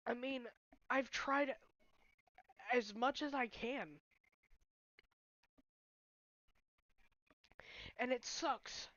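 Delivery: fighting back tears; tearful. Real, not acted. To viewers about friends' blame, near crying. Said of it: "of course I'm gonna cry".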